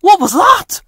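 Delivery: little engine startled by a noise in the fog; high frightened question; nervous. Acted. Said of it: "said Stepney nervously".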